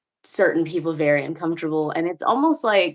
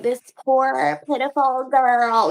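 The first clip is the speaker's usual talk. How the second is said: higher, drawn-out patronizing pity; syrupy mock sympathy, stretched out; 7 semitones higher, slower than usual. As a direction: imitate someone making a show of sympathy. Mimicking performative church pity.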